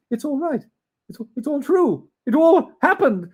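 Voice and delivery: high and loud, marvelling; delighted Scrooge. Acted. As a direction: marvel at every corner.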